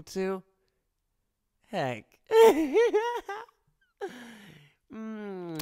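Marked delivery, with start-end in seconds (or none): 2.3-3.4 loud laugh. Acted. Teasing laughter after flirting.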